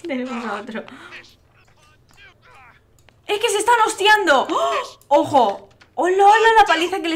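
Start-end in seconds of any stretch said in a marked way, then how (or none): 3.3-7.1 high, loud excited yelps; excited shouting. Real, not acted. Streamer watching his Sims brawl, shouting excitedly. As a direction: shout with glee at the fight.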